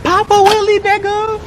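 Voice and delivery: high, loud holler in imitation; high-pitched call. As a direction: call it out way up high, mimicking her. Quoting the girl's call from nowhere.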